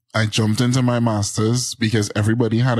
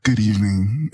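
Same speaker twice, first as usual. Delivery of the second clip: mock announcer greeting; playful imitation of a show opener; same announcer tone; 2 semitones lower than usual. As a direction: lightly put on a radio-host voice. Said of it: "come in on the same tone".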